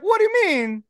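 high, put-on Italian Pope voice. The Pope looks through the narrator's wallet in a high put-on voice. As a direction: charm him while pocketing the wallet.

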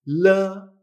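"la" with an l as in Spanish, vowel held; Chinese L sound. Shown as a demonstration. Teacher demonstrates L E, "la" with a Spanish-style l. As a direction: draw out "la" after a Spanish l. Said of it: "exactamente igual que la L".

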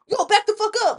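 high, loud warning; retold confrontation shout. Storyteller acting out a warning to the bikers, shouted. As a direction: re-enact a sharp shout to back off.

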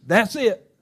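a sudden high cry of relief; retold scream. Charlie Brown jumps up, screaming to Lucy in excitement. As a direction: Charlie Brown leaps up, shouting his discovery. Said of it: "he jumps up and screams".

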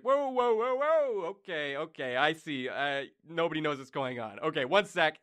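high backing-off whoa; calm the attacker while backing away; god fending off the angry queen; startled god. Acted. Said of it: "is like".

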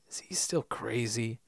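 breathy whispered aside. Retold or imitated. Sawyer whispering to Miles about Faraday. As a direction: whisper it behind his back.

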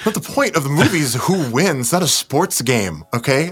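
exasperated. Real, not acted. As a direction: scold a friend who missed the point. Scolds friend for asking who wins.

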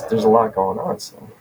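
ordinary talk, nothing marked

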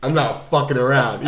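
loud, hammed-up threat; mean it, with a laugh underneath; imitated angry actor. Actor warns himself in the mirror, loud mock rage. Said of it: "You're really good at this impression".

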